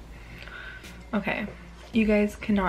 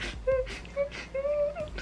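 high crying laugh; 7 dB quieter than usual. Real, not acted. Laughing at the sad scene.

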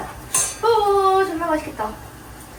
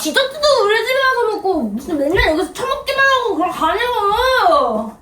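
tearful rant. Real, not acted. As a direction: rant at your sister until it turns into crying. Rant ends in crying. (억울해서 울음 섞어 따지기)

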